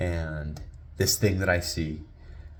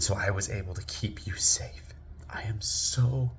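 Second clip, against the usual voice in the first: hushed, slowing fatherly praise; tender pride; 5 dB quieter than usual. Retold or imitated. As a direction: softly let your child hear your pride. Father praises his son tenderly.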